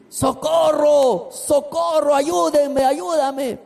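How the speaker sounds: high, drawn-out mock cries of help; a pastor retelling his neighbour's screams for help, in a high pleading voice; frantic cry for help.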